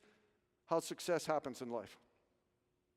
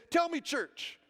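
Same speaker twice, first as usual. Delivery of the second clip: high, raised preacher call-out; imitated preacher; 6 semitones higher, 5 dB louder than usual. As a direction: challenge the congregation like a preacher. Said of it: "when he preached one day".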